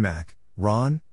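normal talking voice

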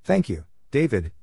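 normal talking voice

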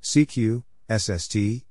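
ordinary talk, nothing marked

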